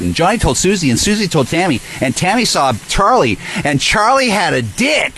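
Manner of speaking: high singsong gossip parody; mocking gossip. Mimicking chat members passing rumours along, mocking gossip.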